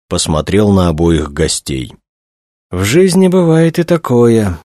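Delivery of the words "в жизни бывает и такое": high, plaintive lament. A narrator's reading. Director laments a young colleague's death to guests, plaintive. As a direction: lament it in a pitched-up, pitiful voice. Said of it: "жалобно произнёс он".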